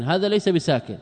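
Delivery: usual speaking voice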